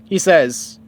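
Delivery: ordinary delivery, nothing marked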